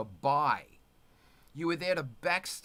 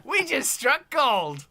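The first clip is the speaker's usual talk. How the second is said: laughing shriek; 12 semitones higher, 6 dB louder, slower than usual. Real, not acted. Delighted reaction to the accent.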